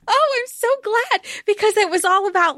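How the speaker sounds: high, gushing delight. Real, not acted. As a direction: squeal with happy relief. Fan delighted by shared note.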